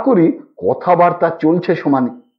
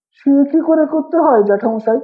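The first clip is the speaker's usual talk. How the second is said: eager; 7 semitones higher than usual. A narrator's reading. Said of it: "আগ্রহের সুরে বললাম".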